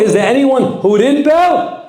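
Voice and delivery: high, loud, slow proclaiming question; calling out. Acted. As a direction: ring it out over the crowd like a preacher.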